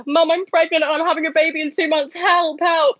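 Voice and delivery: high-pitched, panicky plea; acted panic. Daughter phoning her mum for help, acted panic. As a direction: re-enact a desperate call for help, pitched right up.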